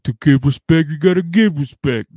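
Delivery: hammed-up gangster cliche; mock tough-guy quote. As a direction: recite it like a hollow motto. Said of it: "fucking quotes like".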